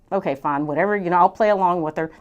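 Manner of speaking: low, offhand mock resignation; eye-rolling. Husband playing along with his wife's idea, eye-rolling. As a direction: humour her, imitating a doubtful husband. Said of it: "he's pretty much rolling his eyes".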